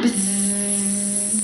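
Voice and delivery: soft long buzzing hum; quiet buzzing sound; bee buzz. Pretend. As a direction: buzz like little bees. Buzzing bees in rhyme.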